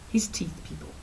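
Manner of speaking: hushed, voiceless aside to viewers; conspiratorial whisper; dramatic whisper. Real, not acted. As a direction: drop to a whisper for the punchline. Building to the gross reveal.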